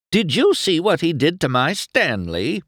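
put-on high-pitched outcry of an old resident; crying out. A narrator's reading. Town's oldest resident appealing about her Stanley, crying out. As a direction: cry out in indignation, pitched high. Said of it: "cried Lizzie, the oldest resident".

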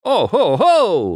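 high, loud "ho ho" laugh. A narrator's reading.

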